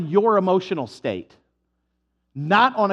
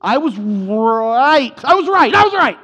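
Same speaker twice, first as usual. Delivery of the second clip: drawn-out then shouted, much higher and louder; straining to admit; 5 semitones higher, 8 dB louder, slower than usual. Acted. Someone who won't admit wrong, building to a shout. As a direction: choke on it, then blurt it out loud. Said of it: "watch their mouth".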